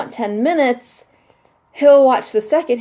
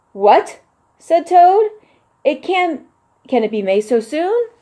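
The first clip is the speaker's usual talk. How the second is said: put-on lively children's book narration; picture book read-aloud; 2 semitones higher, 4 dB louder than usual. A narrator's reading. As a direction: read it like bedtime story time for kids.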